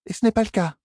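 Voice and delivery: very high, taunting inner voice; small high voice of mockery; mocking little voice. A narrator's reading. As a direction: jeer from inside her head, pitched high. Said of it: "railla la petite voix".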